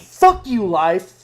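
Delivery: loud mock outburst; mock shout. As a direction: yell it at life in jest. Mock rant at life.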